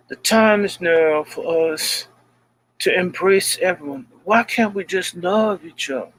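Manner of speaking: a hint of a congressman's speech; quoting the congressman's video in a light impression; imitating John Lewis. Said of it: "the thing where it says".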